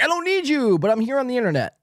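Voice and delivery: high, exaggerated mock defiance; mocking parody. Host quoting the streamer to show his contradiction, mockingly. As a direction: mimic him way up high, dropping toward the end. Repeats the mocking quote of clip.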